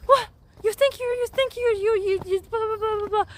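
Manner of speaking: high-pitched scolding rant; exasperated parent, tumbling into blah-blah; imitating angry parents. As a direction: berate a crying kid in a shrill mock-parent voice. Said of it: "my parents like".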